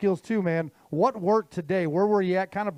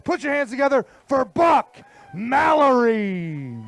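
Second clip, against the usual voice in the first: big weigh-in emcee introduction, name stretched out; stage announcer; 3 semitones higher, 6 dB louder, slower than usual. Acted. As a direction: call the angler up with a drawn-out name. Introduces an angler to the crowd.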